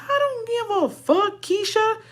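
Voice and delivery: mock him in a high sassy voice; high-pitched sassy retort. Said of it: "And I said".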